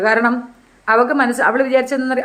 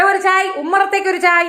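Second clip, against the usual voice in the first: high, sustained tea call; sing-song raised-pitch order; 6 semitones higher than usual. Acted. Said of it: "ഒരു ചായ പറയട്ടെ".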